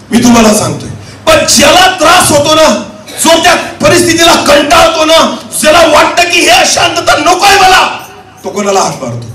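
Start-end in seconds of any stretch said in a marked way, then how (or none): 1.3-7.9 high, urgent sermon voice; impassioned preaching. Acted. Preacher describing one fed up with unrest, impassioned preaching. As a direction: build phrase on phrase, insistently.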